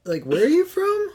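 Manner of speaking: high-pitched, baffled outburst; mock disbelief. Friend playing viewer shocked by actress's real accent, mock disbelief. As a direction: play up the shock, voice climbing very high.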